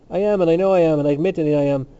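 despairing. A narrator's reading. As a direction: admit it in emphatic despair. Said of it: "shaking his head in despair".

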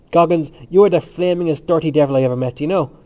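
loud, emphatic good-natured abuse; vehement, without anger. A narrator's reading. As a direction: hurl the insult forcefully but without anger. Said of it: "said vehemently, but without anger".

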